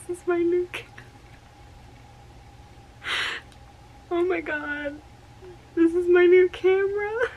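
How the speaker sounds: high, teary, overwhelmed gushing; pitched high and nearly crying with joy; overjoyed squeal. Real, not acted. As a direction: squeal over the new camera like a dream come true. Dream camera unboxing, overjoyed.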